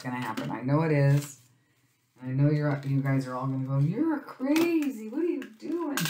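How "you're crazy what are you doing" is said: high, slow mock scolding; mock viewer scolding. Viewers scolding her, high and slow.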